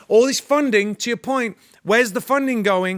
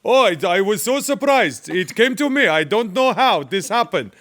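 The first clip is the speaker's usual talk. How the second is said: mock Farsi accent. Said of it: "Even his Farsi is better".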